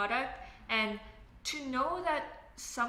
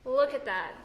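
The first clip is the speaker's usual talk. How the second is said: surprised; 3 semitones higher, 10 dB louder than usual. Real, not acted. Reacting to the table finish.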